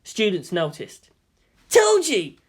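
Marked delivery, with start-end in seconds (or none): 1.7-2.4 high, loud triumphant cry; overjoyed. A narrator's reading. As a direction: crow it like you called it all along. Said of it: "said out loud, overjoyed".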